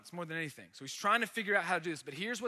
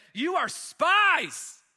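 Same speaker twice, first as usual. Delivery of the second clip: slow, much higher and louder, hammering the charge; harsh accusation; 10 semitones higher, 10 dB louder, much slower than usual. Acted. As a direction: accuse them like a stern governor. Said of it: "he spoke to them harshly".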